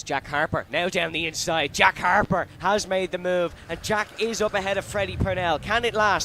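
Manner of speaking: rising race-call excitement; motorsport commentator. Real, not acted. Overtake on the inside, live call.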